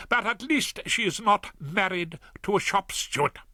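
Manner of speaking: wife handing her husband a cloth cap in scorn; mocking wife. A narrator's reading. Wife mocks husband with flat cap.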